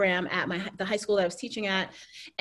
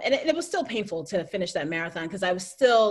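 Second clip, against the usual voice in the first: normal talking voice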